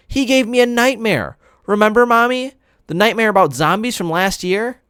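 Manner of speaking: put-on high, eager little boy voice; high, excited young brother; little boy Jamie. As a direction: play a small kid telling on himself.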